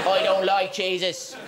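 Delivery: put-on light Irish accent, cheese for Jesus. Irish resident answers the evangelist, light Irish accent. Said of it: "with the Irish accent".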